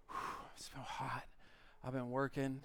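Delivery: exhausted whisper. Retold or imitated. A man worn out from yardwork, gasping in a soft whisper. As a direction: whisper it out, spent after yardwork.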